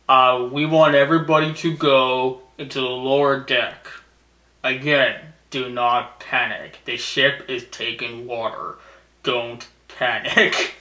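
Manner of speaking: deadpan announcer telling passengers to stay calm. Retold or imitated. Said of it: "I like the announcer".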